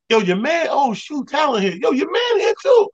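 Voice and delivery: imitated security guard, pitched well up; excited bouncer. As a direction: tip him off like a hyped doorman. Bouncer tipping him off, excited.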